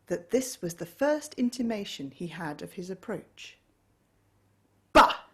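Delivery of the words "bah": loud scornful bark of bah; grumpy bah. A narrator's reading. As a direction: spit out a dismissive bah. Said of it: "said Scrooge".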